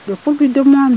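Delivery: plain reading voice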